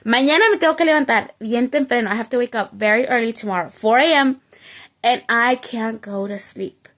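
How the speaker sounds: hurried anxious rattling-off; panicked fast self-talk about waking early; fast frustrated rush. Retold or imitated. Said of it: "I was so frustrated".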